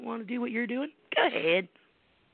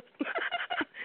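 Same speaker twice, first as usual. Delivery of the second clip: breathy laugh; 4 dB quieter than usual. Real, not acted. Laughs after mock "Duh.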